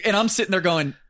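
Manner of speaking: ordinary talk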